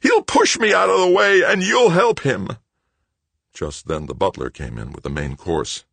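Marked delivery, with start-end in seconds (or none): 0.0-2.6 full, high, anguished shout; howled. A narrator's reading. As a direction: wail it out at the top of the voice. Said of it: "Greg howled".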